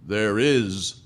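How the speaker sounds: slow, weighty pronouncement; voice from cloud. Acted. Computer turned pink cloud answers the question, solemnly. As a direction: pronounce it like an oracle, word by word. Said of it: "out of the cloud came a voice".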